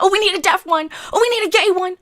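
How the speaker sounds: high-pitched mock-eager quote; mocking imitation. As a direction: mimic studio people ticking boxes. Mimics studios ticking diversity boxes.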